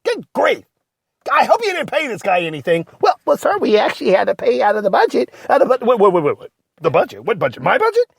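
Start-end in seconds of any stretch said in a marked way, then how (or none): none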